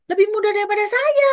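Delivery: high, loud incredulous question; acted shock at a revelation; retold astonishment. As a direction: exclaim it in disbelief, pitch shooting up. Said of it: "Aku bilang gitu kan".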